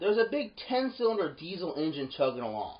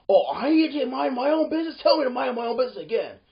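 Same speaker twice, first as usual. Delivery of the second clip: higher, louder, jeering challenge; aggressive trucker; 7 semitones higher, 7 dB louder than usual. Retold or imitated. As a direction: throw his words back at her, daring her. Trucker mocks back, aggressive.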